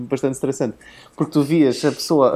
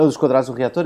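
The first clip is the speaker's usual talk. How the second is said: usual speaking voice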